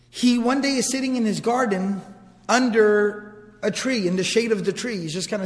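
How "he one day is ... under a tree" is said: usual speaking voice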